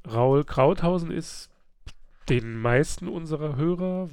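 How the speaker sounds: ordinary talk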